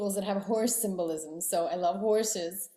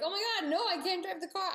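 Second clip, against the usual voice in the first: much higher, half-joking panicked refusal; mock panic; 12 semitones higher, faster than usual. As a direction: squeal it like you're scared to take the wheel. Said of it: "I'm like".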